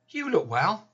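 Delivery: high, bright mock-cheery voice; sing-song false cheer, pitched high; chirpy visitor's greeting. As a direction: parrot the well-meaning small talk. Repeated mimicked greeting.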